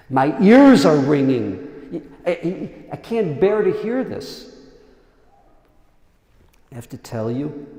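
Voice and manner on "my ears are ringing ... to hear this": much louder, slow pained outcry; anguished cry. Retold or imitated. Kinnah author recoiling at the brothers' talk, loud anguished cry. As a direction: cry out that you cannot bear to hear it. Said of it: "So the author of the Kinnah says".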